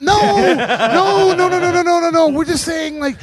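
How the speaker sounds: panicked backpedal. Retold or imitated. Casting director backpedaling.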